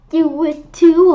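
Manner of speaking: loud, high, slow insistence on too; bickering. A narrator's reading. Sister bickering with Arlo, loud and high. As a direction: shout it back at him. Kids bickering back and forth.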